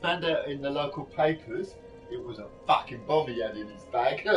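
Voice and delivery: ordinary delivery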